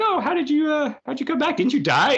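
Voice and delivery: high, eager mock question; curious crew member. Crewmate asking Shax how he came back, high and eager. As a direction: chirp it up high, nosy.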